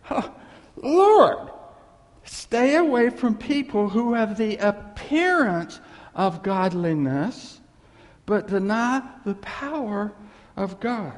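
slow, loud, drawn-out proclamation; slow fiery sermon delivery; emphatic preaching. Real, not acted. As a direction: preach it with full force.

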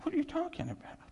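unvoiced "Jeez" rising to a high, incredulous question; mock-baffled Pilate; a hint of an exasperated governor; Pilate's bewildered reply. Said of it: "Pilate's going".

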